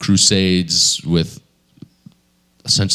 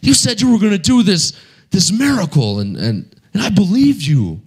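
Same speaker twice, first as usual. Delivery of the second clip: high, pained complaint to God; a betrayed preacher arguing with God; frustrated with God; 8 semitones higher than usual. Retold or imitated. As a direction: let the hurt climb up high. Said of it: "He's all frustrated with God".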